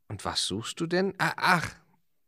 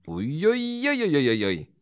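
playful uiuiui; 5 semitones higher, 5 dB louder than usual. Real, not acted. Reacts to the stamping plan.

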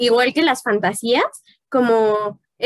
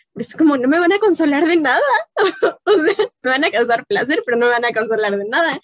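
laughing; 4 semitones higher, faster than usual. Real, not acted. Joke about the word consoladores.

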